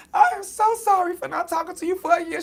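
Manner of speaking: high, tearful, breaking voice; crying apology. Retold or imitated. Apologizing to her sister through tears, crying hard. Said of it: "I think I started crying".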